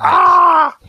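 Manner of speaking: loud, high cry of pain; long, piercing mock-agony yell; acted pain scream. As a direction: scream as if struck, hammed-up. Player acts out being hit.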